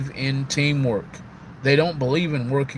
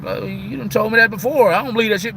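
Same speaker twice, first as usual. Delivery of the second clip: sassy comeback; 8 semitones higher, 3 dB louder, faster than usual. Retold or imitated. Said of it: "And I'm like".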